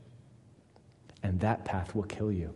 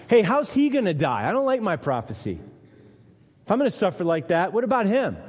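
Peter complaining; 8 semitones higher, 6 dB louder than usual. Acted. Peter voiced complaining about prophecy.

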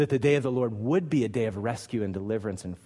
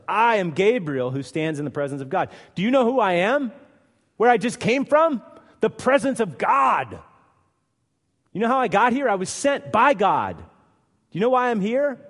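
loud, high-pitched indignant challenge; emphatic Gabriel; 10 semitones higher, 8 dB louder than usual. Acted. Angel sent by God rebuking Zechariah, loud and emphatic. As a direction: thunder it out, pulling rank on a doubter. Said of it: "with an emphatic I of his own".

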